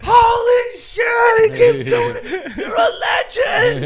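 very high, slow, loud amazed shout; hyped friends. Retold or imitated. Friends cheering that he snuck back in, loud slow shout. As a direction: cheer him like a hero.

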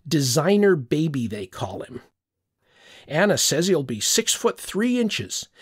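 ordinary delivery, nothing marked

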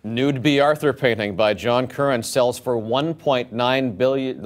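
slow, grave anchorman headline read; serious news anchor voice. Pretend. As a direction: read it like solemn evening news. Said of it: "I'm reading them in serious news anchor voice".

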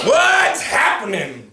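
loud, slow, high hearty bellow; blaring greeting. Acted. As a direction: holler it to the hunter. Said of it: "blared Tacky".

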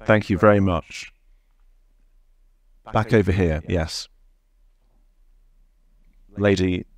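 ordinary talk, nothing marked

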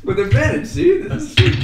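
thrilled and high; bursting with glee; excited. Real, not acted. Gleeful over rolling with advantage.

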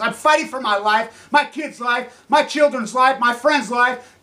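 loud, high angry yelling; angry shouting. Real, not acted. Ranting vlogger yelling at the pacifists, angry. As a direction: hurl it at the pacifists.

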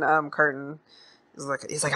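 normal talking voice

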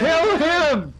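high playful yell; mock shout. Gamer yelling at infected teammate, playful. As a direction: yell it over the others. Players yelling in zombie game.

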